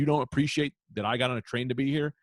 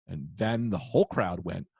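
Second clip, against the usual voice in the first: normal talking voice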